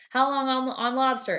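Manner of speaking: head chef checking the cook time; Gordon asking. Retold or imitated.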